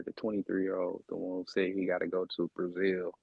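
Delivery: usual speaking voice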